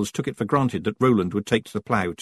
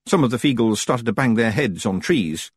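ordinary delivery, nothing marked

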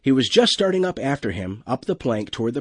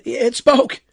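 high, loud amazement; astonished crewman; 13 semitones higher, 7 dB louder than usual. Acted. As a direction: blurt it out, stunned. Crewman startled by talking animal.

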